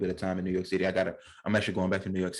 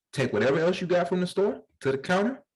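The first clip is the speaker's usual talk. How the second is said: ordinary delivery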